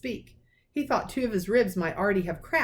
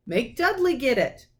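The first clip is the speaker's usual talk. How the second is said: defiant retort; 6 semitones higher, 4 dB louder than usual. A narrator's reading. Harry retorting.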